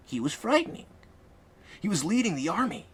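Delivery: put-on high, light girlish voice; Whimsy's voice. A narrator's reading. Whimsy recalling the masked leader, high-voiced.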